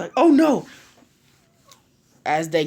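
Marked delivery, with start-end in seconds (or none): 0.2-0.7 high, loud cry of alarm; sudden loud jump into alarm. A narrator's reading. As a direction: yelp it out in dismay. Naruto dismayed at being rushed.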